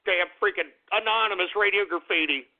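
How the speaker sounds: ordinary talk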